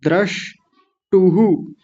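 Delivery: द्रष्टुहु said very slowly, syllable by syllable, with a clear द्र; careful pronunciation. Shown as a demonstration. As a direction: stretch out द्रष्टुहु, sounding out the द्र. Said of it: "मैं थोड़ा इधर लिख देता हूँ".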